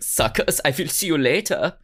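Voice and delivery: taunting farewell in mock German accent. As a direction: toss it off in comic German English. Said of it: "I don't know why he has a German accent".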